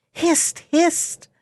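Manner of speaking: high hissing talking meat; hissing call. A narrator's reading. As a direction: hiss the warning high. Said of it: "called out for the third time".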